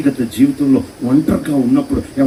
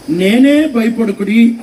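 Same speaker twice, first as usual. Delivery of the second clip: raised, higher, drawn-out call; Jesus calling out; 6 semitones higher, 4 dB louder, slower than usual. Acted. Jesus to disciples screaming in the boat, calling out. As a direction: call out slowly over distance to frightened men. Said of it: "అప్పుడు యేసుప్రభు ఏమన్నాడంటే".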